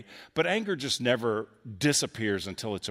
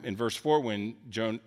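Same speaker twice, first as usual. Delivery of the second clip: ordinary talk, nothing marked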